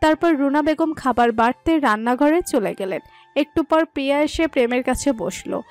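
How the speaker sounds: ordinary delivery, nothing marked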